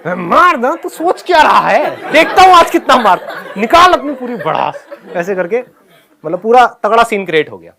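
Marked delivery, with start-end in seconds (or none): 0.1-4.9 much higher and louder, laughing through the rage; furious dare. Retold or imitated. Angry student taunting the trembling PT teacher, laughing shout. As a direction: yell the challenge, daring him to hit harder.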